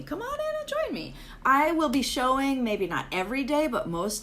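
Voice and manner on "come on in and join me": much higher and fast, cheery call; bright come-on-in call; excited invite. Real, not acted. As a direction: sing out the welcome. Enthusiastic invitation.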